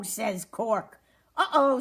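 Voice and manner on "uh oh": high, loud storybook uh-oh; dismayed uh-oh. A narrator's reading. Fuzz has hurt Cork, dismayed. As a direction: realize the mistake with acted dismay. Fuzz sees his mistake.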